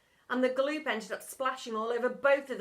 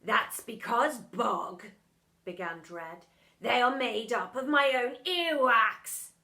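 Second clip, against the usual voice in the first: lightly voiced pompous teacher, louder; a hint of a sneering schoolmaster; stern teacher; 7 dB louder, slower than usual. A narrator's reading. Teacher gloating at the boy, lightly pompous.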